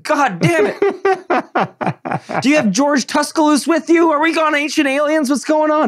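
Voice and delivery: high, laughing mock exasperation; exasperated neighbor. Host playing himself fed up with [name], high laughing whine. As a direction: whine it in a high put-upon voice.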